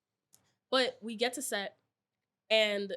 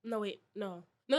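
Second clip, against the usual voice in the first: normal talking voice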